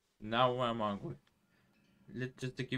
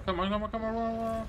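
urging; 11 semitones higher, 6 dB louder than usual. Real, not acted. Tense moment in a game.

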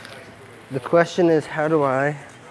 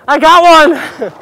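much higher and louder, drawn out; triumphant cheer; 11 semitones higher, 12 dB louder, much slower than usual. Real, not acted. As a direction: shout it in delight. He makes a save.

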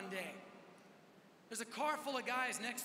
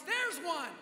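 higher, louder acted-out shout; excited yell; 6 semitones higher, 6 dB louder than usual. Friend spotting a kid in the park, yelling. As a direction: yell it like you've finally spotted someone. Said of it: "And he just yells".

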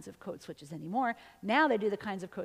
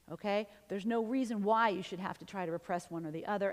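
ordinary delivery

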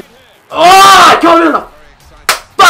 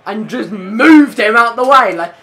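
normal talking voice

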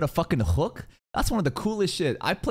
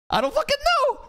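exasperated outburst. Real, not acted. Streamer baffled by the spell, high exasperated outburst. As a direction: throw up your hands at it.

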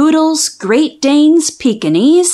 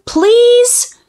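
pleading; 9 semitones higher than usual. Acted. Title refrain, begging dog.

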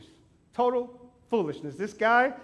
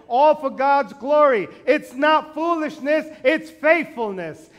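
slow, loud preacher proclamation; full-voiced sermon declaration; preaching; 6 semitones higher, 8 dB louder, slower than usual. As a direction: drive it home from the pulpit. Preaching climax.

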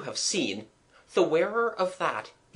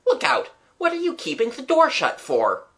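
high, raised schoolboy protest; calling out; 11 semitones higher, 4 dB louder than usual. A narrator's reading. Boy shoving at a door his friends hold shut, calling out. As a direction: call through the door, high and insistent.

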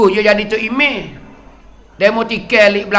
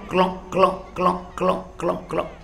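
bubbling sound; 3 semitones lower, 9 dB quieter, faster than usual. Retold or imitated. Imitating boiling pot.

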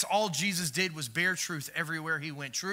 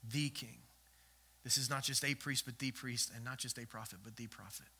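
softer and softer, hitting "the"; stress on the; 5 semitones lower, 8 dB quieter than usual. Real, not acted. Pastor hushing as he stresses "the prophet". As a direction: fade to a hush, leaning on "the". Fading a/the contrast.

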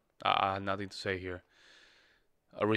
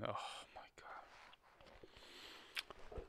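very slow, voiceless whisper; stunned whisper; 24 dB quieter, much slower than usual. Real, not acted. Reactor shaken by musician's death, stunned whisper. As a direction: breathe it out in slow disbelief.

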